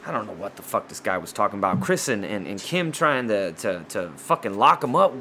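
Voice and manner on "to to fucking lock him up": ordinary talk, nothing marked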